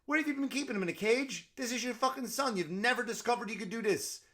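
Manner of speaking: high, fast, disbelieving; incredulous rant. Retold or imitated. Teller ranting at the mother, high and fast. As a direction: berate her in amazed disbelief. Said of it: "I go".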